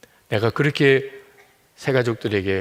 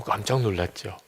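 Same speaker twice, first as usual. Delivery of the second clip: ordinary talk, nothing marked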